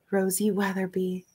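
usual speaking voice

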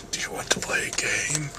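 hushed, whispered villain impression; very soft, creepy whisper of a film villain; Jigsaw. Vlogger attempting a hard villain impression, whispered. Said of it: "It's, like, really hard to do".

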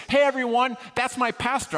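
high, loud proud announcement; calling out. Retold or imitated. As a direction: shout it to the whole room. Guy announces his pastor to the room.

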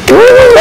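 loud, high whoop; excited cheer. Acted. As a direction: whoop for the team. Players cheer team announcement.

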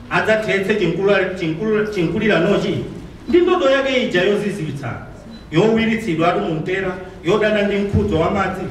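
declaimed stage poem, lines falling at the end; poem recitation. Acted.

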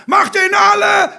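high, loud bellow from the sidelines; full-force furious shout; snapped shout. A narrator's reading. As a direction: yell it as a bloodthirsty football dad. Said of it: "schnauzte der Glatzkopf".

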